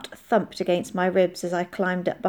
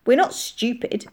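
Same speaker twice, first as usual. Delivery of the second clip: higher, indignant retort; 4 semitones higher than usual. A narrator's reading. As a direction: bristle at being underestimated. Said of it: "said Molly indignantly".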